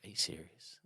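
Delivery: very soft, incredulous question; hushed disbelief. Retold or imitated. Runner balking at the boss voice, hushed and incredulous. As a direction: barely voice the disbelief.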